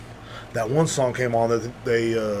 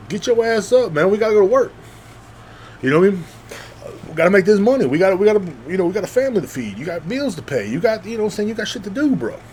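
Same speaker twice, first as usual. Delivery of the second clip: tough pep talk; 7 semitones higher, faster than usual. Retold or imitated. Voice of duty telling a sad man to work, pushy. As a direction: bark him back to work. Voicing the demand to keep working.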